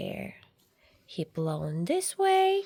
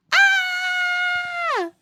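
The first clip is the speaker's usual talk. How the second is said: mock scream; 6 dB louder than usual. Fright again.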